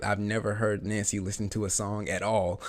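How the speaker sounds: normal talking voice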